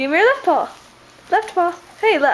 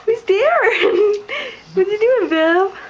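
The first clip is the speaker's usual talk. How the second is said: high, sing-out excited question; playful excitement; 6 semitones higher, much slower than usual. Real, not acted. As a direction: wind the dog up with anticipation. Exciting the dog about someone arriving.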